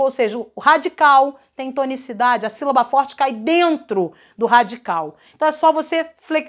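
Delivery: ordinary talk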